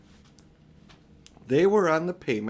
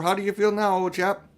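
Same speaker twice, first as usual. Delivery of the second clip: higher, louder kindly gent; concerned bystander; 7 semitones higher, 5 dB louder than usual. A narrator's reading. A bystander asks the injured policeman, higher and louder.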